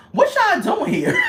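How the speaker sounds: high, loud mock challenge, laughing.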